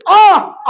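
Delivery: loud, high wail of despair; anguished ah. Acted. Preacher voicing the doomed servant, a loud anguished wail. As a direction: let out a sharp cry of regret. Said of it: "চিৎকার দিয়ে বলবে".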